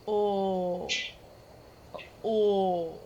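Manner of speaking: long, low, rounded Minnesota "Oh"; imitating a friend's Minnesota "Oh"; mock Minnesota oh. Mocking Jace's Minnesota accent, a low drawn-out "Oh". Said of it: "he went".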